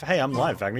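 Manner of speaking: ordinary talk, nothing marked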